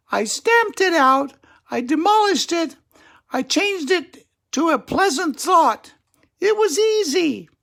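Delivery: very high, sweet little squirrel voice; calm squirrel lady. Acted. Squirrel greets Attila at the club, very high voice. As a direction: play a tiny serene squirrel. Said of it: "said Angelica".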